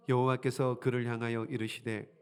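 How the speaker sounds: low, measured reading; scripture reading. Acted. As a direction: read the verse aloud solemnly.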